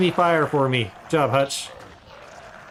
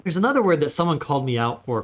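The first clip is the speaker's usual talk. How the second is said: ordinary delivery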